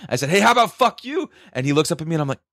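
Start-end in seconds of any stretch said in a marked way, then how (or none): 0.3-1.3 high-pitched retold comeback; acted-out outraged retort; indignant retort. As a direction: fire back at a cheeky kid, voice jumping up. Retort repeated in retelling.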